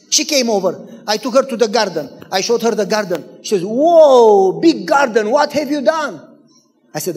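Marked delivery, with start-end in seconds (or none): 3.6-6.2 big loud whoa, then rising pitch; acted astonishment at the sight; amazed. Wife seeing the neighbor's garden, amazed.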